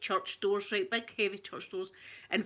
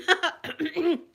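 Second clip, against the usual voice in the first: loud, high stifled laugh bursting out; imitated laughing; 7 semitones higher, 7 dB louder than usual. Me watching the spider at the funeral, laughing helplessly. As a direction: act out helpless funeral giggles. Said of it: "like that and laughing".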